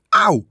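high, loud yelp; sharp pained shriek. A narrator's reading. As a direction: yell out in sudden pain. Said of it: "gilde hij".

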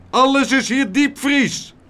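high, loud roar; bellowing. A narrator's reading. Meneer Tump explains the frozen food to everyone, bellowing. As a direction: proclaim it at the top of the voice. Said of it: "bulderde meneer Tump".

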